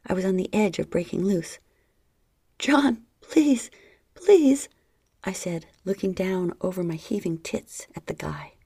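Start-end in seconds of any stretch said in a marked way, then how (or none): 2.6-4.7 high loud desperate plea; high loud begging. A narrator's reading. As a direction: beg him, rising.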